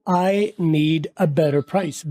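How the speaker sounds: I need a better price said very slowly with better dragged out; each word of I need a better price drawn out; slow careful model. Shown as a demonstration. Teacher slowly modelling I need a better price. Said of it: "better को better".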